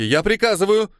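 high, loud, cracking into a yell; breaking into a scream. Acted. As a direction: lose control and scream the command. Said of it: "она сорвалась на крик". (срывается на крик)

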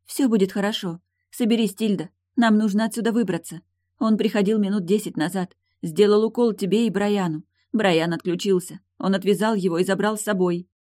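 pressing, anxious reassurance; calming her down. A narrator's reading. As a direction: pull someone together with reassurance. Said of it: "начала успокаивать её Эйлин".

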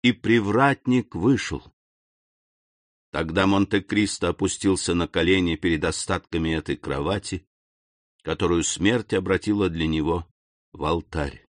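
ordinary delivery, nothing marked

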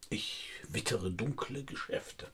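langsam und leise geflüstert; verschwörerisch geflüstert. Acted. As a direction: raunt es wie ein Geheimnis. Detektiv raunt verschwörerisch. (Detektiv raunt der Sekretärin langsam seinen Verdacht zu)

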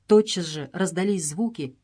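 ordinary delivery, nothing marked

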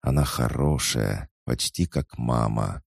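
ordinary delivery

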